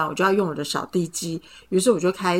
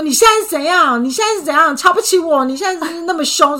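higher, louder indignant retort; fierce retort; 9 semitones higher, 5 dB louder than usual. Retold or imitated. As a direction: snap back at her, offended. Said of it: "他就凶我说".

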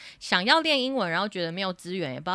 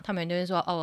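ordinary delivery

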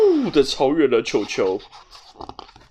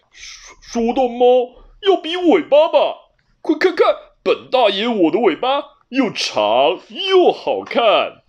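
high, loud slow-starting boast; flaunting the tail; proud cat; 8 semitones higher, 7 dB louder than usual. A narrator's reading. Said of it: "布鲁斯得意地转动了尾巴". (得意的布鲁斯，高声炫耀尾巴)

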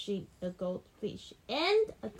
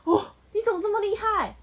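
louder, higher, fast delighted praise; excited praise; 8 semitones higher, 8 dB louder, much faster than usual. Real, not acted. Mother praising the child's right answer with excitement.

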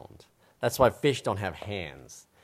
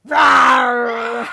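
tiger roar; 17 dB louder than usual. Acted. Tiger in knock knock joke.

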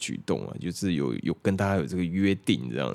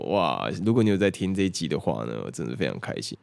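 normal talking voice

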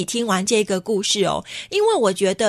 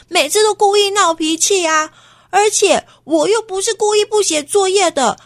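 louder, higher indignant complaint; upset protest; 10 semitones higher, 5 dB louder than usual. Acted. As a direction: protest loudly against the unfair blame. Frustrated boy protesting. (委屈地大声抱怨)

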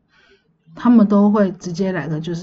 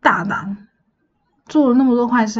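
angry; 4 semitones higher than usual. Retold or imitated. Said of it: "城隍爷就很生气".

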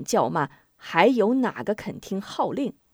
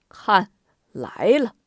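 eager; 5 dB louder, slower than usual. Acted. Expecting news of capture.

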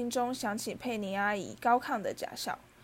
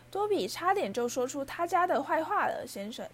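high elf voice, dropping on "sir"; Dobby; 6 semitones higher than usual. A narrator's reading. House-elf explaining his self-punishment to Harry, high and servile. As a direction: speak as a high-pitched, servile creature. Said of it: "小精灵说".

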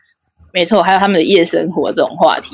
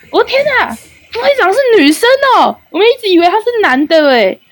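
much higher, astonished retold outcry; amazed outcry; 12 semitones higher than usual. Male guild members realizing she is a girl, much higher amazed outcry. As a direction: gasp it out in disbelief. Guys discover she is female.

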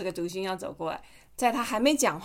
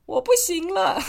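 high, loud hammed-up wail; defeated monster; 10 semitones higher, 6 dB louder, slower than usual. Mother playing the beaten monster crying out in mock despair. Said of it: "他就说".

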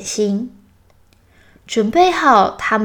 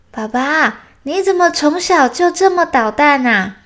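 put-on delighted child character, pitched up; higher-pitched, playful cub voice; amused little bear; 7 semitones higher than usual. A narrator's reading. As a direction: tease Dad cheerfully in a higher voice. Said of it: "小熊听完笑得好开心".

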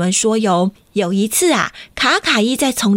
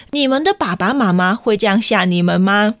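plain reading voice

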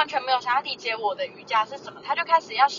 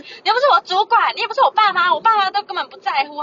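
louder, heated tirade at the uncle; angry rant; 6 semitones higher, 7 dB louder than usual. Retold or imitated. To the meddling uncle, a heated, louder rant. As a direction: let the anger pour out.

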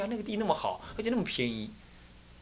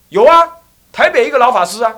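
high, very loud retort; loud shouted answer; loud defiant reply; 9 semitones higher, 15 dB louder than usual. Acted. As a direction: shout back triumphantly. Old man answers defiantly.

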